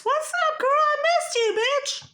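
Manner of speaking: high, sing-song mock hello to sis; mock sweet greeting. Mock greeting to her sister.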